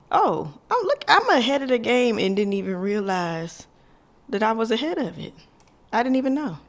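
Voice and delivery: high, loud excited discovery; delighted surprise. Real, not acted. Vlogger realizes she's ahead of the game, delighted surprise. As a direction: exclaim as if catching yourself ahead.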